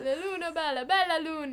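put-on sing-song it like an old Italian grandpa; old Italian man. Friend joining in imitating the grandpa's Italian. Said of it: "sweetest little old Italian man".

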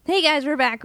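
ordinary talk, nothing marked

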